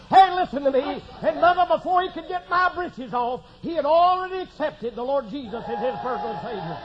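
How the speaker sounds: ordinary talk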